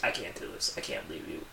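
hushed Mary Jane pleading; soft pleading. Retold or imitated. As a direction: plead gently to him, almost under breath. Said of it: "she's like".

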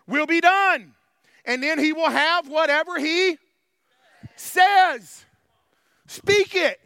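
loud, punched key words with long stretches; full-force preaching. Real, not acted. Preacher challenging the congregation about their words, full-force preaching. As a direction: preach it hard, voice pushed up.

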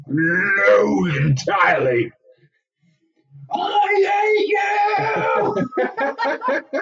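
high, crying shout of hate; young Grinch outburst. Retold or imitated. [name] acting the young Grinch yelling in class, high crying shout. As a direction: wail it like a bullied kid.

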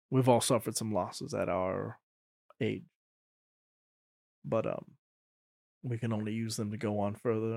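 low, soft and grave; consoling quietly with weight. Acted. Brand consoling the grieving father, low and solemn.

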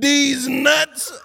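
loud, high mock heckle; yelled punchline. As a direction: belt out the prank line, imitating the guy. Retold prank punchline yelled at him.

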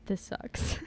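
unvoiced, whispered gripe. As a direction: whisper it like a grumbling couple. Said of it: "they're like".

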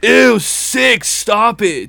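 high, squealing "ew, sick"; mock valley voice. Teasing his friend's story, high squealing mock disgust. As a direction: play a grossed-out teen girl. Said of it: "very funny when we do talk like that".

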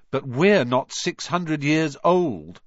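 put-on slow, emphatic boy's voice; Ron reminding. A narrator's reading. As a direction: spell it out slowly. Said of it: "Ron reminded her".

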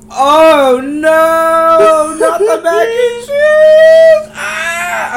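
high, loud sung wail of horror; dramatic wail. Pretend. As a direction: wail it like a diva in despair. Mock outrage over mac and cheese.

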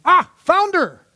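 high, loud, drawn-out exclamation; mock excited discovery. Husband jokes about reading the proverb, mock excited cry. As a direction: cry out as if finding a treasure, hammed-up. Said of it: "I wasn't reading this going".

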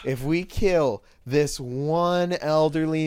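loud, slowing address to the crowd; rally the villagers with slow, hammed-up emphasis; mock village leader. Acts leader pitching a sacrifice to villagers.

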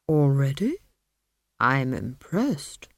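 put-on a put-upon old tree, grumbling; sulky talking tree with a grudge; aggrieved tree voice. A narrator's reading. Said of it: "said the tree".

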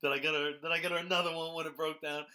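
loud, high-pitched imitated boast; mock bragging. Speaker quotes the husband pressing his wife, loudly bragging. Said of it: "justified brag, but he's like".

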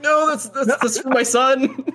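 high, pleading voice breaking into laughter; dying soldier plea. Retold or imitated. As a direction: beg to keep it, laughing through. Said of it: "It's like".

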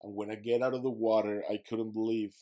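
usual speaking voice

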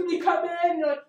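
ordinary talk, nothing marked

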